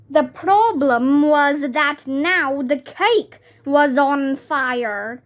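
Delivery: usual speaking voice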